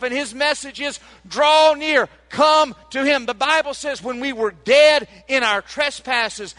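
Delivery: preaching at full force. Acted.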